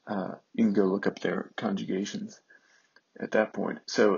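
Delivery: ordinary talk, nothing marked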